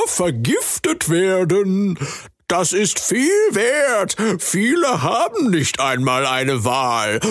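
high, slow scheming frog voice; a selfish frog justifying himself, slowly; sly frog creature. Acted. Frog creature explains to Jamie why he steals, high slow voice.

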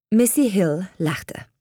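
usual speaking voice

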